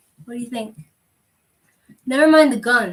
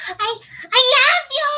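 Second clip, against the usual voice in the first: high squealed declaration of love; playful scream; 10 semitones higher, 4 dB louder, faster than usual. Pretend. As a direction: scream it high, drawing out the last word. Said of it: "I didn't mean to scream".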